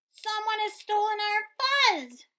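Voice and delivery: high, loud alarmed cry. Acted. Pip alarming the town, high loud cry. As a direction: cry it out to all. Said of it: "cried Pipsqueak".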